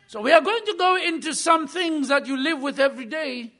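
usual speaking voice

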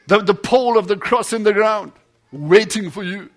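loud, driving sermon delivery; preaching at full force. Acted. Preacher thundering the story to his congregation, full force.

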